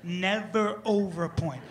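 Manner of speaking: never overpoint, drawn out and stressed; preaching a golden rule, slow; slow, emphatic rule. Shown as a demonstration. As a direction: lay down the rule slowly. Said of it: "Always please underpoint".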